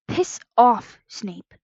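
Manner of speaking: angry, hissed retort. A narrator's reading. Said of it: "Remus hissed".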